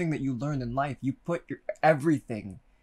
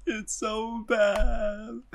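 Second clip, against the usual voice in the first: crying; 8 semitones higher, much slower than usual. Real, not acted. Repeated in tears.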